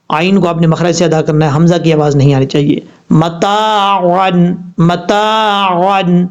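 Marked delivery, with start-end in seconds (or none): 3.1-6.3 متاعن very slow, the عین from its proper point and the الف drawn out; tajweed recitation. Shown as a demonstration. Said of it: "اس طریقے سے". (متاعن کو عین کے مخرج سے، آہستہ لمبا کر کے پڑھیں)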